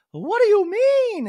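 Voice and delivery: high, loud, drawn-out put-on; mock Dorit voice. Host mimicking Dorit's alarm, high and loud. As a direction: ask it in a high, slow, hammy voice.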